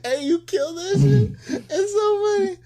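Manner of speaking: very high, squealing through laughter; helpless laughing. Real, not acted. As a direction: crack up while praising the joke. Cracking up at co-host's impression.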